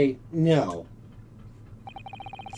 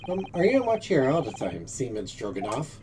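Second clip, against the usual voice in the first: ordinary talk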